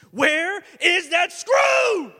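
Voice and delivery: a loud, drawn-out shout of panic; slow, desperate shouted question; frantic shout. Retold or imitated. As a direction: yell it like the screw just vanished. Retold frantic search for screw.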